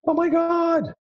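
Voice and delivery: very high, drawn-out "oh my God"; high-pitched mock astonishment; amazed exclamation. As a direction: squeal it in hammed-up amazement. Exclaims over the buying statistics.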